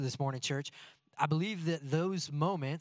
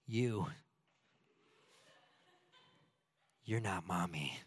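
put-on much lower, softer, deadpan child; flat toddler voice; 7 semitones lower, 5 dB quieter, slower than usual. Pastor mimics his toddler daughter snubbing him, lower and soft. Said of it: "she says".

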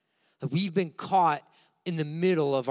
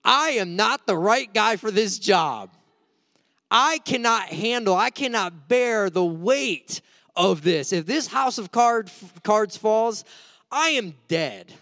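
higher, strained, slow thought of doom; mock dread; 4 semitones higher, 4 dB louder, slower than usual. Young helper bracing under the piano, hammed-up dread. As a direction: play up the fear of collapse. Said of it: "I remember sitting there thinking".

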